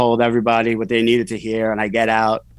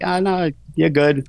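high, slow, airy brush-off; mock breezy dismissal; 7 semitones higher, slower than usual. Friends on the phone brushing off his plea, mock breezy. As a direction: imitate friends waving it away, lightly sing-song.

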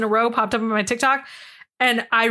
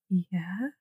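whispered; 5 semitones lower, 10 dB quieter than usual. Retold or imitated. Margie answers in retold bird story.

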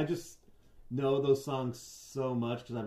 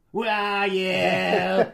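nasal rock singer whine; nasal singer impression; 3 semitones higher, 9 dB louder than usual. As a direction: mimic a whiny pop-punk singer. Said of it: "with that nasally voice".

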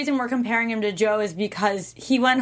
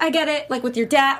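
usual speaking voice

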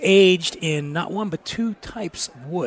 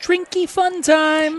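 high, drawn-out singsong call; show-name fanfare; 12 semitones higher, slower than usual. Pretend. Host announces the new podcast's name, singsong fanfare. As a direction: sing out the name, slow and high.

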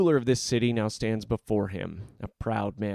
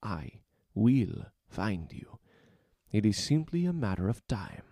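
put-on Spanish accent; 3 dB quieter than usual. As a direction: promise it quietly, in a Spanish accent. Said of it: "Spanish accent".

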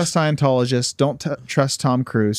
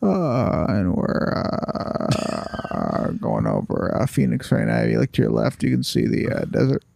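pilot announcement voice; 4 semitones lower, 3 dB quieter, slower than usual. Retold or imitated. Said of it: "why do pilots go like this".

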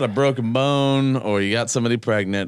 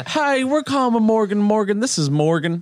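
high-pitched phone pitch voice; pretend law-firm caller; 9 semitones higher than usual. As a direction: play a hammed-up caller introducing the firm. Rehearses prank call as law firm.

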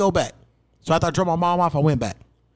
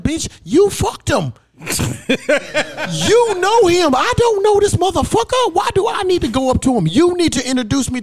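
retold outburst at mom; 14 semitones higher, 4 dB louder than usual. Son yelling at his mom to go up herself, loud and high. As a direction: yell it at your mom, disbelieving.

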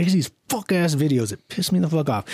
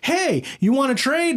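much higher, hammed-up eager influencer voice; mock YouTuber voice; 9 semitones higher than usual. Mocking a YouTuber offering a stranger his car, high and hammed-up. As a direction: imitate a too-cheery YouTuber making an offer. Imitating Mister Beast in retold video.

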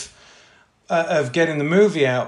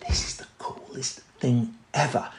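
hushed to himself; 4 semitones lower, 8 dB quieter than usual. Retold or imitated. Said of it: "and I'm going".

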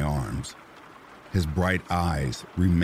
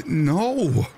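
high, loud cry of terror; 11 semitones higher, 5 dB louder than usual. A narrator's reading. Wife hears her brother escaped, terrified. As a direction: gasp it out in horror. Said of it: "I said in terror".

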